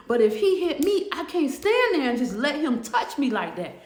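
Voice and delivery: worked-up imitated argument; heated insistence. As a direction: act out an inmate standing her ground. Inmate insists she will hit back.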